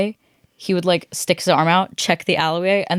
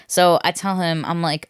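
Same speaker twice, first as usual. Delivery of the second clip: ordinary talk, nothing marked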